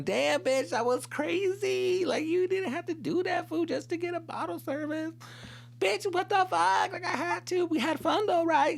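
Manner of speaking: high-pitched mock girl voice; gossiping girlfriends. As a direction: act two girlfriends swapping wild secrets up high. Voices the girl and best friend.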